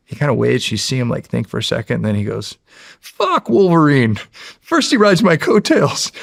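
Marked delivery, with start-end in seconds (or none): 3.0-6.1 higher, louder, slow hammed-up Deadpool; Deadpool line. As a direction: ham up the kid's Deadpool monologue. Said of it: "with all inflection".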